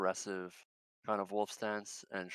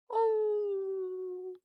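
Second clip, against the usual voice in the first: high, long howl to announce himself; wolf howl; 4 dB louder than usual. Acted. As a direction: throw a howl up high to make his presence known. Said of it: "Kif is going to let out a howl".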